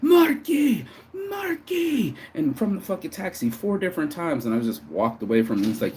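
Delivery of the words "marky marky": high screaming call of a name. Retold or imitated. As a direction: yell the name up high and loud. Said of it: "He starts screaming at me".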